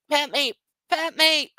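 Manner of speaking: high, slow little puppy begging. Pretend. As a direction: voice the dog asking to be petted.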